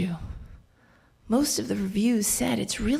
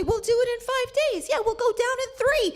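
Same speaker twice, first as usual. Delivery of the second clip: much higher mock guide voice, breezy; mimicking the guide; faster than usual. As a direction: parody the guide brushing her off. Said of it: "So he tells me".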